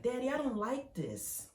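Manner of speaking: put-on high whiny little-kid voice; a scared kid whining to daddy; whining child. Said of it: "you went to whine".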